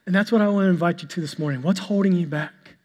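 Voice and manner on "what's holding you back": ordinary talk, nothing marked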